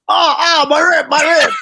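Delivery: high, loud cry of pain; mock pained cry. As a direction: wail about the rib. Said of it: "they're like".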